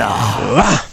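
short, low put-on grunt; mock tennis-player's grunt. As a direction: grunt as if hitting a tennis ball. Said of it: "Of course I can do a grunt".

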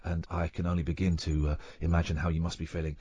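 very posh, soft English apology; lightly voiced, bumbling posh Englishman; Hugh Grant impression. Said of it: "I did my very best Hugh Grant verbally".

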